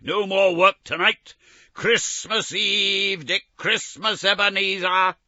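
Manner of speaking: loud, high, hearty call; a jolly master rallying his boys; jovial Fezziwig. Acted. Fezziwig to his apprentices, loud and jovial.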